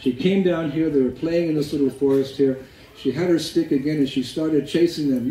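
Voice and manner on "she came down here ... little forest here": usual speaking voice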